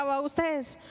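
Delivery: normal talking voice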